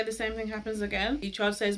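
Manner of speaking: normal talking voice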